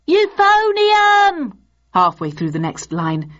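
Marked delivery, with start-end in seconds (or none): 0.1-1.5 very high, loud, drawn-out heckle; high-voiced shout. A narrator's reading. Someone missing the cue entirely, shouting high. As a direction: squeal it out late from the back. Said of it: "shouted in a high voice".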